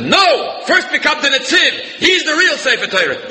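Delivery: high-pitched urgent cry of "No!"; screamed out. Retold or imitated. Rabbi Yitzchak screams at the crowd, high urgent cry. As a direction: call out urgently to save the rabbi first. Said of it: "he screamed out and said".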